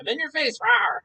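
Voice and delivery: high mock roar; a silly little monster rawr; playful monster growl. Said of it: "you jump up and say".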